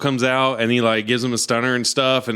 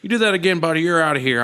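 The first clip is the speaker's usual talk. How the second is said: higher, lightly voiced firm warning; mock stern threat; 5 semitones higher, faster than usual. Camera guy threatening to throw the fan out, mock stern. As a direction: threaten him like a bouncer. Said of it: "He's like".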